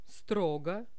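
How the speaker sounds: strict. Shown as a demonstration. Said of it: "Я могу читать".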